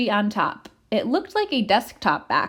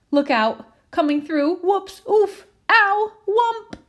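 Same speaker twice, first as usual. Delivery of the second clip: rising yelps, higher and louder with each bump; stumbling in the dark; 10 semitones higher, 3 dB louder, slower than usual. Acted. Potato stumbles through the dark room, yelping higher each bump. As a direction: cry out as you crash into things.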